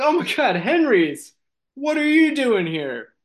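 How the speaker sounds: high-pitched acted astonishment; shocked recognition. Kenny and Marison spot their friend in the theater, shocked. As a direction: exclaim at a friend who shouldn't be here.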